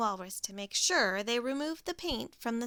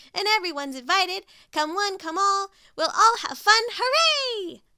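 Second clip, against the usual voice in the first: much higher and louder rising cheer; shouting Pig; 9 semitones higher, 10 dB louder than usual. Acted. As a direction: cry it out to the whole town. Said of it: "shouted Pig".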